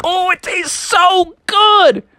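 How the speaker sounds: high, slow delighted sigh of taste; savoring Froggy. Acted. Froggy eating the marshmallow bait, high slow delight. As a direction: relish the forbidden treat. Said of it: "He's like".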